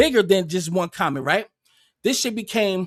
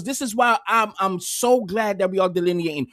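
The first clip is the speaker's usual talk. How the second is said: usual speaking voice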